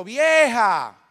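loud bark of a call. Retold or imitated. Father on the sofa calls mother in the kitchen, loud call.

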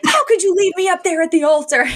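high-pitched, hammed-up indignant wail; mock outrage. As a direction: burst in with imitated outrage, voice way up. Voicing outraged mother bursting in.

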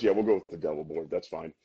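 low, fast, dropping off to nearly nothing; resigned dad. Retold or imitated. Retelling the father giving in to his daughter, low and mumbled. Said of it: "And then Dad goes".

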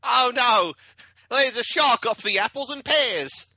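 high, slow, hammed-up Cockney; mock Cockney accent. Mock Cockney panicking about the shark, hammed up. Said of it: "I can't do British 'cause it turns into Australian".

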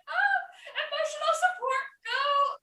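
high, laughing, teary squeal; a high giddy laugh-cry; laughing through tears. Retold or imitated. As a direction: squeal it while laughing and half crying. Laughing-crying retold reaction.

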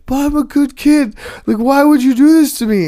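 hammed-up child pleading for his life. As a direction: wail like a terrified six-year-old begging. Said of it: "crying and pleading for his life".

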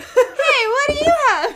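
high, loud mock mom call through laughter; mom impression. Mom questioning her little boy, high laughing imitation. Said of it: "And she, the mom".

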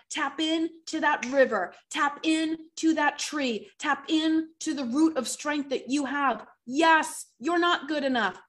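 preaching with building force. Real, not acted. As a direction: call them to tap in, building.

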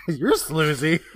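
giggling through the words, slowly; laughing. Real, not acted. Teasing her co-host over a botched word, laughing. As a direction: crack up while teasing back. Laughing at a tongue-twister slip.

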